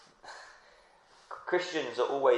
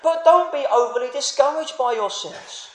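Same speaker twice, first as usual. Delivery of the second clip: raised, earnest voice of the apostle urging on; Paul's encouragement; 12 semitones higher, 7 dB louder than usual. Retold or imitated. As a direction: voice Paul pleading with the church. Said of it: "He's saying".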